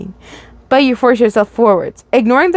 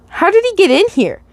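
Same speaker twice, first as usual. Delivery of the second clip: baffled; 10 semitones higher than usual. Acted. Guard Hale asks, baffled.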